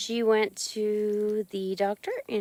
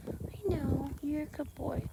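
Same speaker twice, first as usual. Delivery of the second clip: soft pet praise; 3 semitones higher, 12 dB quieter, slower than usual. Real, not acted.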